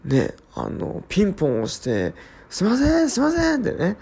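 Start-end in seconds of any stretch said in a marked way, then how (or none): 2.5-3.6 insistent caller. Retold or imitated. Prankster calling to the resident from outside, insistent plea. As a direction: call pleadingly through a door. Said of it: "ドアの向こうから語りかけるだけ".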